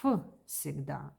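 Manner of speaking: ф alone, then всегда opened on that same "f"; в pronounced as ф. Shown as a demonstration. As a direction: say всегда starting with an "f" sound. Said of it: "it sounds like".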